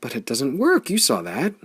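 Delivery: eager but doubtful; excited doubt. A narrator's reading. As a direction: protest through rising hope.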